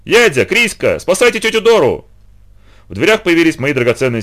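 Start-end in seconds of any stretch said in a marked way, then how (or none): 0.0-2.0 higher, louder urgent call through the door. A narrator's reading. Boy pounding on the sisters' door, calling out loud. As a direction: shout for the sisters to come.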